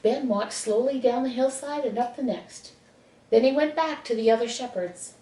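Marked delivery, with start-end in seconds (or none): none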